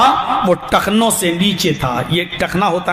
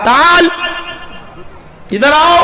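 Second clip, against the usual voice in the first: commanding summons; 6 semitones higher than usual. Acted. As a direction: order him over. Umar summons him.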